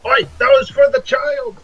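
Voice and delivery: very high, loud outraged yelp; indignant pirate shout. Pretend. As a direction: yell at the thief like an angry pirate. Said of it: "pirate shouts at thief".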